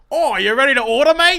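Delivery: loud, high hammed-up Aussie accent; over-the-top Australian server voice; Australian waitress. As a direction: greet the table in broad mock Australian. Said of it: "You're the waitress".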